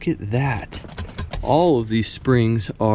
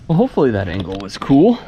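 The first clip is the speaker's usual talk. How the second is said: ordinary talk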